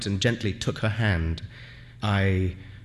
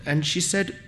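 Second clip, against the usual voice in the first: plain reading voice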